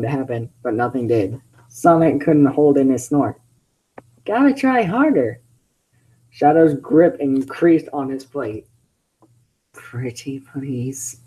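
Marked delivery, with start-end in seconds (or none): 4.2-5.4 high cocky teasing voice; Sonic teasing voice. Acted. Sonic taunting Shadow, high and cocky. 9.7-11.2 very soft, very slow grudging plea. Acted. Shadow forced to beg, soft and slow.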